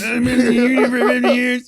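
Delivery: high, loud caricature echo; mock union old-timer. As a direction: parrot the cartoon union veteran loudly. Echoes the union man caricature.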